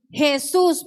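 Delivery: ordinary delivery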